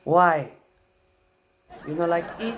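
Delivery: put-on loud, high hurt Filipino-accented why; grandma offended you won't eat; upset grandma, Filipino accent. Upset grandma scolding the refusing grandson, Filipino accent. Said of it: "She gets upset".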